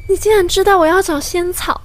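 surprised. Acted. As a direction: gasp in realization. Amazed discovery.

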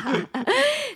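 short high burst of a laugh. Real, not acted. Brushing off a silly joke about breakfast, a high laugh. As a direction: laugh off the teasing.

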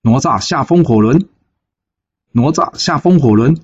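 high, ringing battle call to come down; a general hollering at his foe, high and insistent; calling out a challenge. Acted. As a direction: shout the name across the battlefield. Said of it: "张桂芳在喊".

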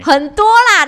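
又高又响地笑着说; 大笑着认账; 笑着承认. Real, not acted. 被取笑内心戏多. (被老公调侃后大笑承认)

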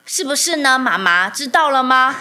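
put-on a toddler lecturing mom, slowly and loudly; voicing her lecturing toddler. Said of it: "他就说".

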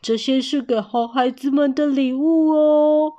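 high, loud storybook gift-giver voice; Santa. A narrator's reading. Santa giving children presents, festive role voice. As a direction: announce gifts in a put-on festive voice. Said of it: "耶诞老公公说".

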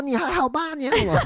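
high, laughing, hammed-up worried call; mock worried calling. Knocking on the toilet door, high mock-worried call.

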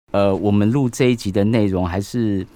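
ordinary delivery

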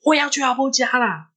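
high-pitched, stubborn outburst; retold fierce insistence. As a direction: act out a cross sleepwalker insisting. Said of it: "他说我很凶".

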